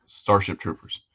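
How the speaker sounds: ordinary delivery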